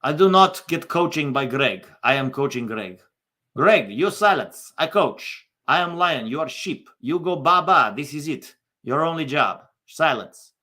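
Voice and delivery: imperious mock Russian accent, punchy short phrases; Zlatan. Star striker putting coach Greg in his place, mock Russian accent. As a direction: lay down the law in a hammed-up Russian accent. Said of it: "Zlatan's Russian all of a sudden".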